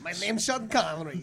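Sean Connery impression. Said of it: "all these impressions".

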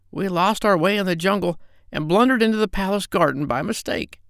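high squawky parrot. Acted. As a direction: chatter like a parrot. Parrot character voice.

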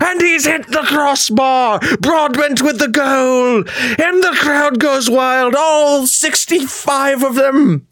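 high, slow, drawn-out mock English commentator; bad English commentary. Streamer re-enacting his video, bad English commentary. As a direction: call the match in a hammy English accent. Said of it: "I broke into a bad English commentary".

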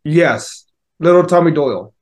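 ordinary delivery

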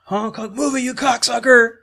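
cranky mayor yell. Retold or imitated. Quoting the mayor driving.

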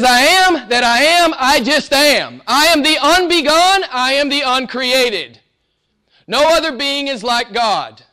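high, loud, slow divine declaration; God declaring himself to Moses, booming. Acted. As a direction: thunder it as the Almighty. God voiced answering Moses.

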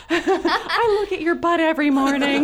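very high, loud squeal through laughter; hammed-up swooning fan squeak; starstruck fangirl. Fan's secret thought before her idol, starstruck laughing squeal. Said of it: "That's what I was thinking".

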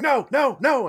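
high, loud repeated exclamations; mock protest. Player acting out being blasted in a game, mock protest. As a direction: cry out each word in mock alarm.

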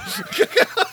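punchline. Retold or imitated. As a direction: deliver the punchline in a put-on voice. Punchline of retold Colbert joke.

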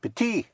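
"Petit" ending on the EE vowel, T silent; French "Petit", high, with no closing T; silent final T. Shown as a demonstration. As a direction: say "Petit" in French, leaving the last T unsounded. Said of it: "sounded unless it is followed by an E".

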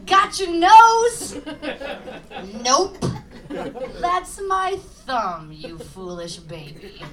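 loud gleeful teasing, then a sneer; taunting a baby. Acted. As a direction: gloat over a fooled baby. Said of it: "taunting a baby".

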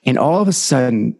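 normal talking voice

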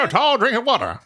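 high vintage comic voice; old-timey wisecrack. Acted. Host continues the old-time ogler bit, old-timey comic voice. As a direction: crack wise like an old slapstick comic. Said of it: "Like the Three Stooges".

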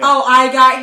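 loud and quick, for all to hear. Retold or imitated. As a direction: say it so the whole line hears. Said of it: "so I said it loud enough".

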